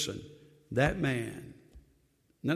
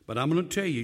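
ordinary talk, nothing marked

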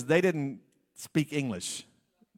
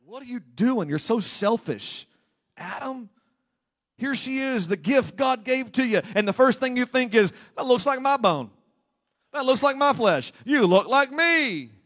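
higher, louder mock reproach; scolding Adam; 7 semitones higher, 6 dB louder than usual. Preacher rebuking Adam for selfishness, loud and high. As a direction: wag a finger at Adam.

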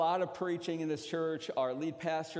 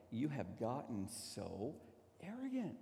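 wife's echoed rebuke; 2 semitones lower, 6 dB quieter than usual. Retold or imitated. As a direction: replay the reproach quietly and deliberately. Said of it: "her voice going".

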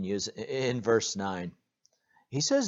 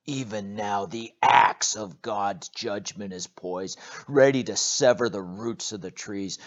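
stern warning; 7 dB louder than usual. Acted. John's warning of judgment.

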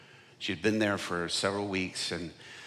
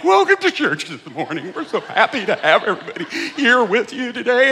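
high, loud, mock-panicked welcome; nervous over-cheery greeting; 13 dB louder than usual. Pastor welcoming church while his hands shake, mock nervous cheer. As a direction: imitate a terrified host forcing cheer. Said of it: "my hands were shaking".

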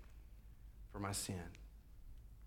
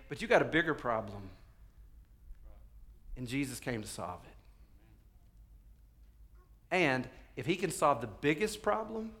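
ordinary delivery

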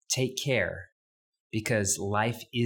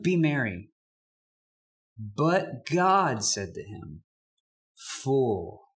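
reads the parable aloud with voiced figures; scripture reading. Pastor reading scripture to the congregation, lightly acted. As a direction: read the rich fool's story to the congregation.

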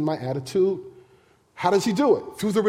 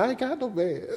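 woman denying; 6 semitones higher, 6 dB quieter than usual. Retold or imitated. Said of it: "she said".

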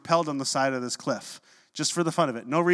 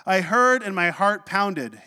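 louder, higher, drawn-out declaration; loud exuberant praise; 4 semitones higher, 5 dB louder, slower than usual. Acted. Prophet calling out to God, loud exuberant praise. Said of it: "He's singing loudly, he's calling out to God".

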